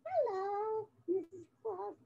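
a hint of a little mouse greeting; mouse puppet voice. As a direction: puppet mouse saying hello to Mrs Claus. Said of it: "Franklin the Mouse".

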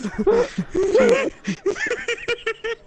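real high laugh, breaking up; high wheezing laugh. Real, not acted. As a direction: laugh high, can't stop.

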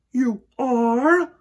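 high, loud startled question; surprised girl. A narrator's reading. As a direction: gasp it out in disbelief. Victoria surprised.

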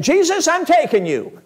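high, forceful mock-desperate declaration; exasperated mother. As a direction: imitate a fed-up mom insisting through her irritation. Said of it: "That's how she said it".

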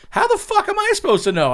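high-pitched, exasperated yelp; exasperated protest. Retold or imitated. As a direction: throw up your hands, squeak it out. Son protests, retold.

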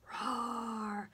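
drawn-out pretend roar; a gentle demonstration roar; dinosaur roar. Shown as a demonstration. Teacher showing children the pretend dinosaur move, gentle roar. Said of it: "we're going to roar".

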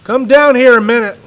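put-on loud, fast call to the boy. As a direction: call him down briskly. Father calls the child down.

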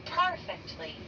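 usual speaking voice

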